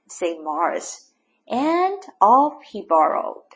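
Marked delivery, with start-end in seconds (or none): none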